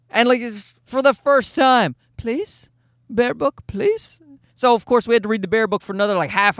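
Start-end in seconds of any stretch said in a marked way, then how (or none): 2.2-4.2 put-on small high toddler voice, questioning; pleading toddler. Toddler son asks dad for the Bear book at bedtime, high pleading imitation. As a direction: imitate a little kid begging with rising pitch. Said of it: "Like, he's saying".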